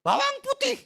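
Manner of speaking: loud, very high mock whine; rengekan tiruan. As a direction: cry out hammed-up longing, high and loud. Meniru rengekan orang Israel. (merengek nyaring dibuat-buat)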